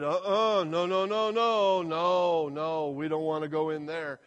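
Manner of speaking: mock frightened spies, shaking it off; no no no, a scared flat refusal; refusing. Spies refuse to enter the land, mock fearful.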